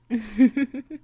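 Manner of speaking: a light, smug chuckle; amused chuckle. Acted. Vampire mistress gloating over mortal minds, smug chuckle.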